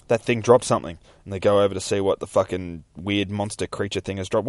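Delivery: ordinary delivery, nothing marked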